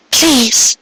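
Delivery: high, pleading female voice; Cupcake's high voice, pleading for a foal; pleading mare. A narrator's reading. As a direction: beg in a high mare's voice. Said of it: "she repeated".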